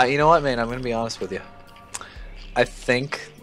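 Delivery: normal talking voice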